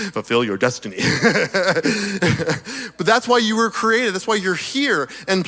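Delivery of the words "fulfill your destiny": very low, laughing Vader voice; Darth Vader voice. Retold or imitated. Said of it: "I sounded like Darth Vader there".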